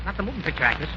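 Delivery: awed hush. Acted. Sudden awe at the name.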